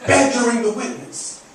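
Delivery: mock courtroom protest; defense attorney objecting. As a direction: jump in as an indignant TV lawyer. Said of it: "he jumped up and he said".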